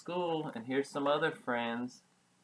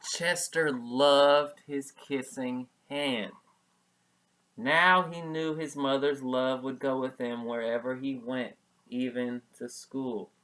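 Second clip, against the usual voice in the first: story narration; 5 dB louder than usual. A narrator's reading. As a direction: savour each word for the kids.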